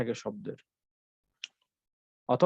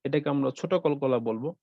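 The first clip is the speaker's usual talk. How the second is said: ordinary delivery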